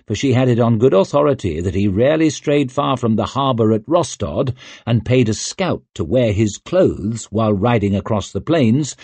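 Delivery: ordinary delivery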